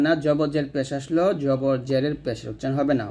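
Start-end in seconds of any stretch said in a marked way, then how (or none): none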